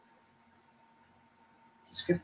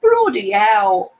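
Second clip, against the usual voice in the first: mock British; 3 semitones higher, 4 dB louder, much slower than usual. Shown as a demonstration. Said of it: "has to say that instead".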